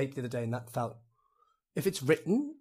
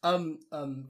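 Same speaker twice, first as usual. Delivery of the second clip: ordinary talk, nothing marked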